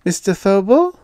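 high, loud questioning call. A narrator's reading. Landlady calls her missing tenant, high rising call. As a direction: call his name up into a question. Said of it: "she called, a little less heartily".

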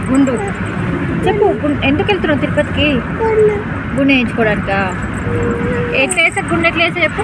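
high, loud sing-song coaxing. Real, not acted. Coaxing child to greet viewers. (పిల్లకు నేర్పిస్తూ ముద్దుగా చెప్పడం)

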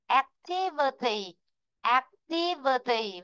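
"Activity" slow, stress on "tiv", rise then fall on "verity"; stress on second syllable. Shown as a demonstration. Teacher drilling the class on word stress, slow and stressed. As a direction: say "Activity" slowly, "tiv" strong. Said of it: "trọng âm sẽ rơi vị trí thứ hai".

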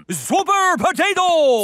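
calling out. Acted. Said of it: "Keep shouting".

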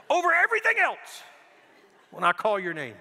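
loud, high insistence; firm call to listen. Retold or imitated. Dad telling his son to hear his voice, loud insistence. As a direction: press the point hard and loud.

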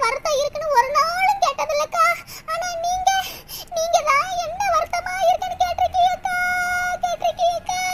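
high wail breaking into sobs; crying. Acted. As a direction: pour it out to her, sobbing. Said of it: "அழுகாத".

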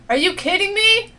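indignant question, voice pushed up; real disbelief. Real, not acted. Game fails, player in disbelief.

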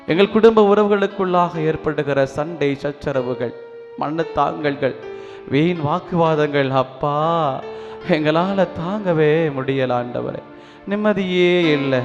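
normal talking voice

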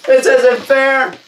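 slow, high, loud wail; panicked protest. Acted. Balloon blower protests his fate, loud drawn-out panic. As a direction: whine it out at the top of your voice.

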